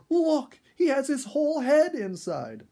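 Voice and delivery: high, loud thrilled shout; girl shouting to her mother about the raccoon; excited cry. A narrator's reading. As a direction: call out to mother in delight. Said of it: "Sonia cried".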